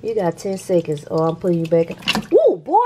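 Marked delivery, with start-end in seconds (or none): 2.3-2.8 sudden much higher, louder jolt; startled yelp. Real, not acted. A grandma startled when her pet kicks her, loud yelp. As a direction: jump as he kicks you.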